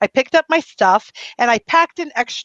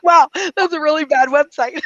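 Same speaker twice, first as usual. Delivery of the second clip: higher, louder, half-laughing mock verdict; laughing self-mockery; 6 semitones higher, 4 dB louder than usual. Guest joking to listeners about her ugly website, laughing. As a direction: tease your own website through a laugh.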